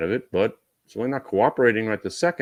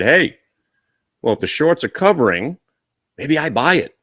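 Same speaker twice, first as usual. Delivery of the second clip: loud, raised voice of a trader; higher, punchy mock-trader quote; acted trader pitch; 7 semitones higher, 8 dB louder than usual. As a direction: pipe up loudly with a new idea. Acts out an eager trader's thought.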